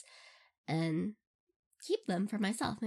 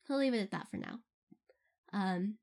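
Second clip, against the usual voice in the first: ordinary delivery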